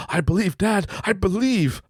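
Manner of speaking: imitating a kid's high, fervent belief; high-pitched wholehearted kid voice; excited child. Son believes in dad's magic, high excited imitation. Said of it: "he's just going out like".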